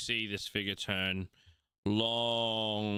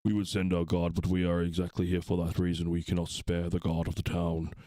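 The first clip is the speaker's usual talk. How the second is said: grave paladin; 2 semitones lower than usual. Acted. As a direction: speak with measured weight about the danger. GM voices paladin.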